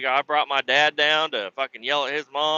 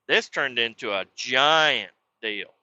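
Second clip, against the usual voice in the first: usual speaking voice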